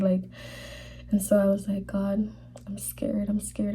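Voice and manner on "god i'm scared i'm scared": hushed, frightened whisper to God; scared whisper. Retold or imitated. Whispered prayer in vision.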